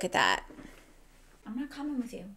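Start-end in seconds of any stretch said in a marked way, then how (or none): 1.5-2.2 softer, higher aside to the cat; telling the cat no, softly; quiet aside to cat. Real, not acted. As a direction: gently turn down the cat, half under breath. Talking to her cat.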